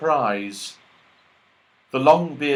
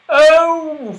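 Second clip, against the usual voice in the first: realising; 11 dB louder than usual. Acted. Franny realising.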